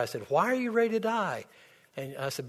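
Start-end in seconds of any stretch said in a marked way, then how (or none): 0.3-1.5 startled question. Retold or imitated. Son questioning dying mother.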